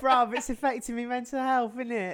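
light mock cockney, hell for health; a playful London twang on bruv and innit; teasing cockney impression; cockney accent. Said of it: "the more cockney she becomes".

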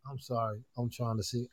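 faint, distant voice on the call; barely audible, far from the microphone; far from mic. Real, not acted. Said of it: "I can barely hear him".

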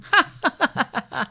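high, rapid ha-ha-ha cackle; acted high-pitched jeering laugh; mocking rabbit laugh. A narrator's reading. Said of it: "he began to laugh".

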